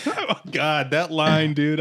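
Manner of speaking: ordinary delivery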